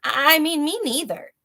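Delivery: a flustered little mouse stammering; tiny little voice. Acted. Mouse agreeing with the boars, high tiny voice. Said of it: "said a tiny little voice".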